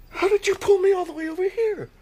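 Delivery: wobbly, near-tears disbelief; tearful. Acted. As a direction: choke up with relief. Dad waking, overcome.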